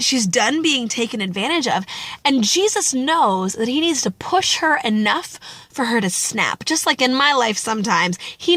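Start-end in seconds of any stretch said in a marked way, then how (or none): none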